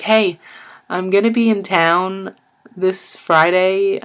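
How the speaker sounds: high, loud, slow sing-song phone greeting; cheery. Retold or imitated. Cousin Christina phones to invite him to the mall, high and sing-song. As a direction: imitate the cousin's bright invitation, drawn out.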